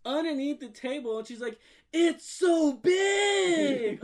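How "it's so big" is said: high, loud, hugely stretched mock shriek; child's amazed shriek. Imitating the kid looking under the table, amazed shriek. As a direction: drag out the last word in a squealing shout.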